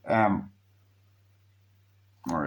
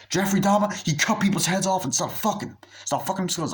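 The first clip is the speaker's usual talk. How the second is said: higher, worked-up explaining; excited friend; 8 semitones higher than usual. Retold or imitated. Friend explains Dahmer to Chris, higher and worked up. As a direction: spill it out, wound up.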